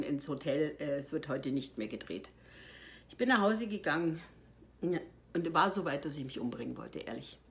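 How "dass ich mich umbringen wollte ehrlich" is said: quiet, heavy admission. Real, not acted. She quietly confesses to the host how low she sank. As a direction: drop lower and much softer on the confession. Admits she wanted to die.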